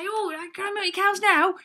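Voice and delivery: high, loud, joyful asking; full of joy. Acted. Drench joyfully asking the neighbours again, high and loud. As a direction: burst with happy hope. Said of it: "she'd be full of joy".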